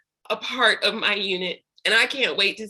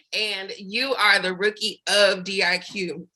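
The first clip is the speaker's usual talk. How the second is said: ordinary delivery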